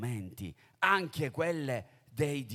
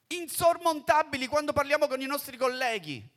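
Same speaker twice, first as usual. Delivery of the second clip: più alto e forte, infervorato; predica appassionata; 12 semitones higher, 7 dB louder than usual. Acted. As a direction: trascina i fedeli con fervore. Predica con forza. (il predicatore esorta i fedeli, con fervore)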